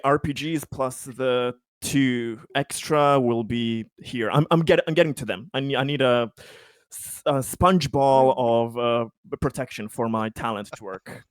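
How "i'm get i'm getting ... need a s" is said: ordinary talk, nothing marked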